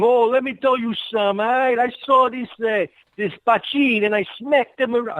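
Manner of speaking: put-on high-pitched Hollywood New York wiseguy accent; exaggerated New York mobster. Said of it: "that only happened in the forties".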